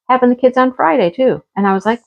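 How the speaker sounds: normal talking voice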